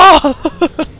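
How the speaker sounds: high, loud cackling laugh. Real, not acted. Player laughing after a play, loud laugh.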